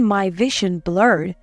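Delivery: ordinary delivery, nothing marked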